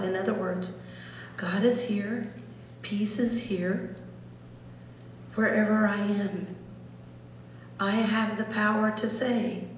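soft, hushed meditation voice; hushed guided meditation. Acted. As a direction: lead them gently into stillness. Leads a meditation.

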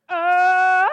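very loud, high, sustained Tarzan call; Tarzan yell. Retold or imitated. Contestant on stage facing the cool girls, Tarzan yell. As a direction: belt out a long yodelling jungle holler. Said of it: "Tarzan calling contest".

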